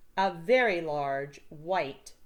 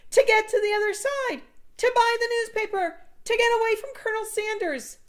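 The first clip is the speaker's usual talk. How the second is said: high, loud shouts through cupped hands; yelled punchlines; 9 dB louder than usual. A narrator's reading. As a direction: bellow each punchline like a megaphone. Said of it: "cupped my hands like a megaphone and yelled punchlines".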